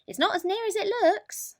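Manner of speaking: high, knowing rabbit voice. A narrator's reading. Rabbit warns Mole about the moon, high and knowing.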